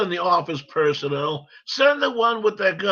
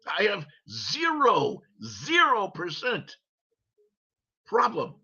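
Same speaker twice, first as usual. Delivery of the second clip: normal talking voice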